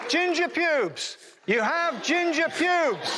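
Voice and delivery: high, slow, bellowed insult; mock shout at a fleeing ginger. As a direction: yell it after him across the field. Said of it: "And I shouted".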